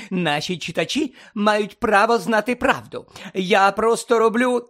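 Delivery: high, loud self-justification; self-righteous protest. A narrator's reading. Reporter defends her article to Harry, insistently.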